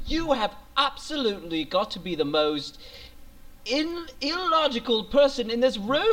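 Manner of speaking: mock British accent. Podcaster mocking a friend, mock British accent. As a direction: sound smart with a fake British voice. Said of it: "if I start talking like this".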